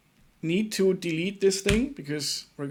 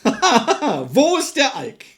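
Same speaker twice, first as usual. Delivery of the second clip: slow, higher, laughing through the words; 8 semitones higher, 6 dB louder, much slower than usual. Real, not acted. Joking to chat about the booze, laughing. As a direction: giggle out the question.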